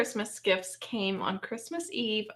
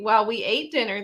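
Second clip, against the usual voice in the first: normal talking voice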